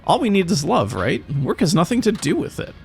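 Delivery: put-on high, dreamy voice of a naive young lover; lovestruck young couple. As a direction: swoon like a lovesick kid in a taxi. Couple in taxi, game dialogue read.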